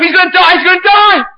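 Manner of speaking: loud, high mock-panicked cry; agitated. Husband telling his wife the hero is doomed, acted agitated panic. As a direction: wail it twice in hammed-up alarm. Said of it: "I'm getting all agitated".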